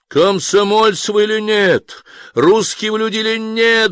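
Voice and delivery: high, loud desperate appeal; pleading call. A narrator's reading. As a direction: cry out to the crowd for support. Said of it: "взывал он". (громкий отчаянный призыв)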